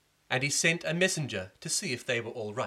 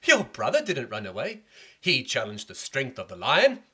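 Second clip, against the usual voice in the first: raised voice; 8 dB louder than usual. Acted. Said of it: "everybody shouted".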